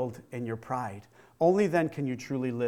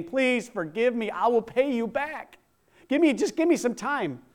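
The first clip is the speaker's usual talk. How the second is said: begging; 7 semitones higher than usual. Acted. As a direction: plead for mercy and more time. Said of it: "begged the king".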